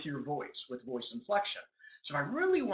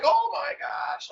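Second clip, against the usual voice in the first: drawn-out, high, loud "oh my gosh"; excited gasp; 7 dB louder, much slower than usual. Retold or imitated. Prospect reacting to his big vision, slow and thrilled. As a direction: let it gush out slowly in awe. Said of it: "I get all excited, and they're like".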